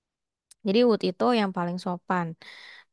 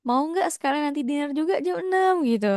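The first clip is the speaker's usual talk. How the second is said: pushy; 7 semitones higher than usual. Acted. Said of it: "langsung ngegas nih".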